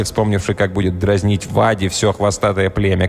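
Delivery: ordinary delivery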